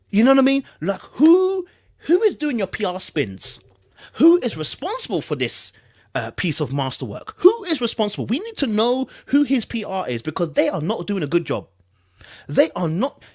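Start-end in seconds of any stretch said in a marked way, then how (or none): 0.1-10.1 higher, louder, disbelieving questions; incredulous rant. Real, not acted. Streamer ranting to chat about the PR team, incredulous and raised. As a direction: demand answers in mock outrage.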